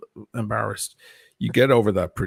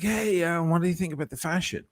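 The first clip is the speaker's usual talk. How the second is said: higher, brighter street greeting; high everyday voice; 8 semitones higher than usual. Shown as a demonstration. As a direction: chat up passers-by. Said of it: "I'm like this".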